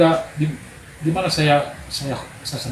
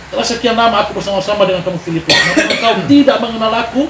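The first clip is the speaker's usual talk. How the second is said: raised, louder reproachful Jesus; mild reproach; 4 semitones higher, 6 dB louder than usual. Acted. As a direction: scold Philip with disappointment. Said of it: "seperti marah dia sama Filipus". (teguran Yesus yang agak marah)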